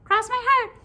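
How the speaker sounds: high, loud squeaky creature; loud high-pitched cartoon voice; little pigtail voice. A narrator's reading. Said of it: "said the pigtail".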